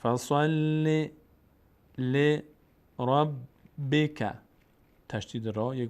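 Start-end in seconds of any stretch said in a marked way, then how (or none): 0.0-4.3 "فصل ل ربک" intoned high and slow; slow chanted model of "فصل ل ربک"; syllable drill. Shown as a demonstration. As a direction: split the doubled letters of "فصل ل ربک". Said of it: "لام تشدید داره".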